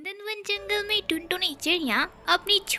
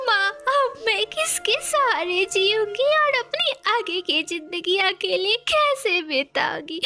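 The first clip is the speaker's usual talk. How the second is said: crying; 6 semitones higher, 3 dB louder than usual. Acted. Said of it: "फूट फूट कर रोने".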